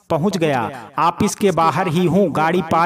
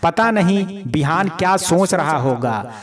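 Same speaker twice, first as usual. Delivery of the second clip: plain reading voice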